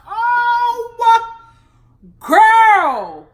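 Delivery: loud, high, stretched-out disbelief; shocked exclamation. Real, not acted. Vlogger reacting to Ronald's record, loud and shocked. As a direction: gasp it out, scandalized and loud.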